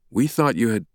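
usual speaking voice

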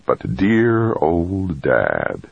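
slow, drawn-out bitter thought; seething inside, voice kept from shouting; inward rage. A narrator's reading. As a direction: stretch each word with simmering resentment. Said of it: "his insides raging".

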